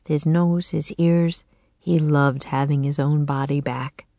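ordinary delivery, nothing marked

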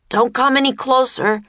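high-pitched warning yell. A narrator's reading. Jack holding the sword at the serpent, yelling. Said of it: "Jack yelled".

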